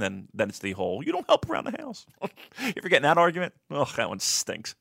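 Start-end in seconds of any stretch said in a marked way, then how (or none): 1.0-2.0 wife's complaint. Retold or imitated. Wife voiced in a story.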